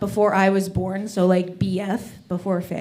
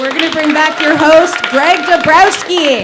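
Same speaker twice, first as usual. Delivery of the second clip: louder, higher emcee announcement; announcer sign-off; 6 semitones higher, 9 dB louder than usual. Acted. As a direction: hand the stage back like an announcer. Comic signs off, introduces host.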